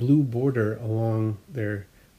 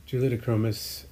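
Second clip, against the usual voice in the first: ordinary delivery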